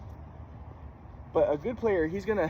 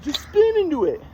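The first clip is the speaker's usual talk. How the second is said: much higher and slow, a mocking take on dad; 12 semitones higher, 4 dB louder than usual. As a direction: parody dad's simple advice. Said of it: "So it's like".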